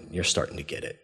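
low, very soft reply; understated, hushed and low; quiet aside. Retold or imitated. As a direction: imitate God answering softly under the breath. God's wry aside to Moses.

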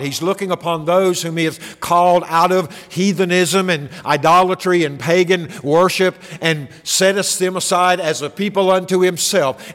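ordinary delivery

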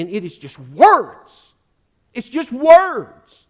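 loud, high, punched-out list; emphatic preaching. Real, not acted. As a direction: hammer each word home from the pulpit. Preacher hammers the point home.

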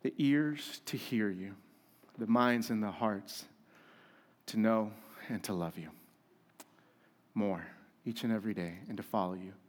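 low, soft, slowing prayer voice. Acted. Pastor closing his prayer to Jesus, hushed and reverent. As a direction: pray it quietly and reverently.

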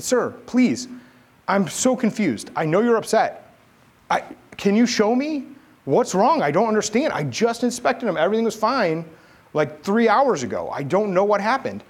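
high-pitched, anxious plea for an explanation; confused pleading. Retold or imitated. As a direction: plead with the boss, bewildered. Retold pleading with yelling boss.

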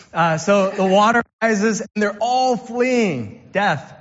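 ordinary talk

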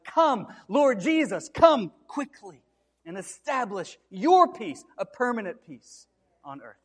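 loud, slow, pleading call to the Lord; fervent cry. Acted. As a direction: cry it out slowly to heaven. Pastor cries out closing prayer of Revelation.

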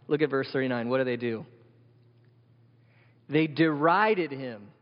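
usual speaking voice

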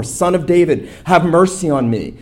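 high, loud desperate plea; a blind beggar crying out over the crowd. Retold or imitated. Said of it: "he cries out all the more".

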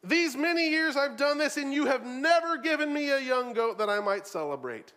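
loud, high, aggrieved tirade; bitter complaint. Retold or imitated. The older brother accusing his father, loud and aggrieved. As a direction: air every grudge at full volume. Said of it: "He says".

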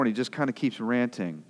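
ordinary delivery

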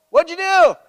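high, loud astonished question; shocked, demanding to know; loud high mock disbelief; incredulous questioner. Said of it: "questioner presses".

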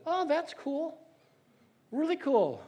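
high-pitched, polite "cool"; feigned approval. Acted. Boy humouring the man reading his Bible, feigned approval.

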